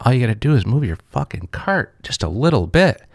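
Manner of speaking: replaying his fed-up outburst; retold anger. Telling the woman to move her cart, retold exasperated. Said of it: "And I was mad".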